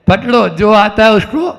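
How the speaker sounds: ordinary talk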